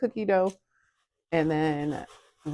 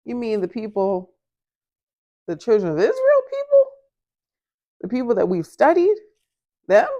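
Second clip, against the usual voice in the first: much higher and louder incredulous questioning; retold shock; 7 semitones higher, 8 dB louder than usual. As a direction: ask it in stunned disbelief. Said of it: "And I said".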